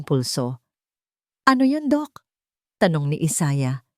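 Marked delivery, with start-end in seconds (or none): 1.5-2.3 urgent question. A narrator's reading. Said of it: "may bahid ng pagkaapura".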